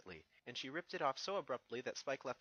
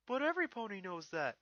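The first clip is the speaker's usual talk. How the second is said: put-on high, loud young dragon voice; Spike's voice; 8 semitones higher, 5 dB louder than usual. Young dragon asks Twilight about the letter, high and eager. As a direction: play the eager little dragon, pitched up.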